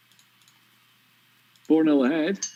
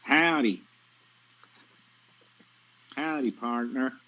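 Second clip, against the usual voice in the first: mock American accent; 3 semitones higher, 3 dB quieter, much slower than usual. Shown as a demonstration. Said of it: "everyone can do one".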